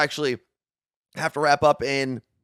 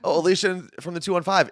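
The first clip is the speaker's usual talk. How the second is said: ordinary talk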